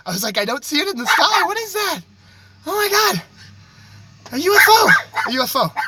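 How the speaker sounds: yelling that he spotted a UFO; excited. Real, not acted. Sees a UFO in sky.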